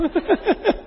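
high burst of laughter; real high chuckle; laughing. Real, not acted. Laughs at own joke.

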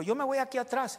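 quoting wife, high. Retold or imitated. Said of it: "mamá Belén dijo".

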